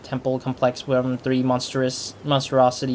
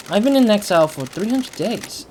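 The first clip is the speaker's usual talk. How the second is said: raised voice for the heroine; character voice; 7 semitones higher, 3 dB louder than usual. Acted. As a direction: play the heroine, a little higher.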